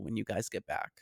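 whispered aside; whispered joke; whispering. Real, not acted. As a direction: drop to a breathy whisper.

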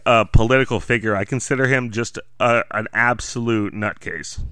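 ordinary talk, nothing marked

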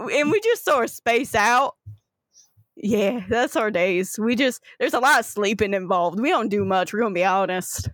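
put-on halting Southern Florida accent; Southern Floridian gator. Gator explaining what they do for fun, Southern Floridian accent. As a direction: list lazy pastimes in a Southern Floridian accent. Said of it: "the gators are the full Southern Floridian".

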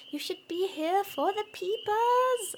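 put-on high, slow New England accent. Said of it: "I'm doing my terrible New England accent".

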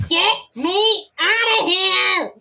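gruff, high, drawn-out demand. Acted. Boxed-up toy demands to be let out, gruff. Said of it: "like, gruffly".

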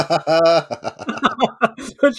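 loud laugh after the punchline; hearty laugh. Real, not acted. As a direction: burst out laughing.

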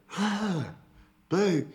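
imitating a waking brother, high-pitched; high, startled call on waking; brother's old voice. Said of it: "He's talking in his, like, old voice".

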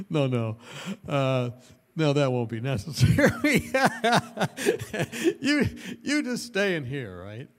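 quoting while laughing; words broken by giggles; half-laughing retold line; laughing through it.

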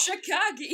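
loud, high, laughing "shi-KAH-gee" for Chicago; film's odd pronunciation. Retold or imitated. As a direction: crow Chicago with a final "gee", amused. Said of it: "they always say Chicago".

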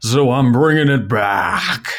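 hammed-up newscaster, drawing out the key words; slow mock newscaster welcome. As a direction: put on a mock news anchor voice with stretched endings. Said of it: "missing my newscaster voice".

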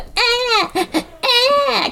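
high-pitched mock baby wail; short, high bleats of a fake infant cry; imitated baby cry. Imitating the film's baby audio for a friend, mock crying. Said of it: "you hear the baby crying".

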